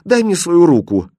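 put-on high, loud little-girl plea; acted frightened child; scared child's cry. A narrator's reading. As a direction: cry out for comfort. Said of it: "закричала Машенька".

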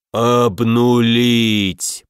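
very slow, drawn-out growl; growling demon. A narrator's reading. Right demon adding his orders, a furious slow growl. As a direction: growl the word out like a huge demon. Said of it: "прорычал правый".

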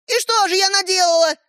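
put-on very high, taunting girl; defiant challenge. A narrator's reading. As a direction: challenge him at a high pitch. Sister challenges him. (с вызовом)

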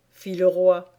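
plain reading voice

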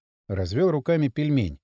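usual speaking voice

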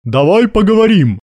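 loud, high call to parley; shouting. A narrator's reading. Major calls the hidden enemy commander to talk, shouting. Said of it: "закричал Гастон".

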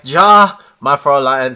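lightly imitated German accent, loud opening; hint of a German comic-book hero. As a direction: play a German hero jumping in, punching the first word. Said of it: "in his thick German accent".

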